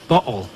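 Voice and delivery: "bottle" with a glottal stop for the tt. Shown as a demonstration. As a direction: say "bottle" with the middle consonant caught in the throat. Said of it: "doesn't have the glottal stop".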